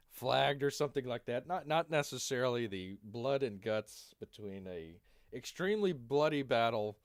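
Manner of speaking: ordinary talk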